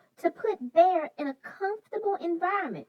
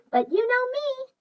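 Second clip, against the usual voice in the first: ordinary delivery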